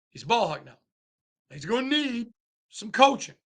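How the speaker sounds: ordinary talk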